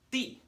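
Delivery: 地 starting high and falling all the way down; falling fourth tone. Shown as a demonstration. Teacher showing fourth tone, high falling tone. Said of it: "as a fourth tone".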